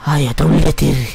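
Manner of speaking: loud, menacing villain voice; hammed-up mad villain ordering guards. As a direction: bark the order like a madman. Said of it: "That's my mad voice".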